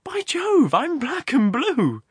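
very high, loud, drawn-out exclamation; artificial jollity. A narrator's reading. Complaining of his bruised arm, with artificial good humour. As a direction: cry out in forced amusement. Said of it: "laughing artificially again".

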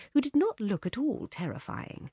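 usual speaking voice

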